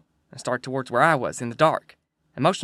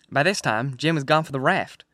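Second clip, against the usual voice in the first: ordinary delivery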